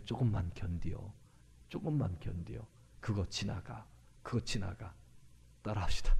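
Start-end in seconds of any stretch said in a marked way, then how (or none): 0.0-4.9 quiet inner encouragement. Acted. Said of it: "제 마음속에서는요".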